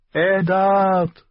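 high, loud woman's cry; calling out. A narrator's reading. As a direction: call Eddard desperately from afar. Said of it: "rief sie". (verzweifelter Ruf, hoch und laut)

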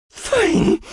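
high, loud strangled gasp. A narrator's reading. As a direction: choke the word out. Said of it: "keuchte er".

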